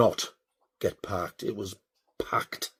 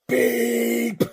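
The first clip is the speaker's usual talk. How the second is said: long high beep; imitated car horn; 12 semitones higher than usual. Driver warning the reversing car, imitated horn beep. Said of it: "and I went".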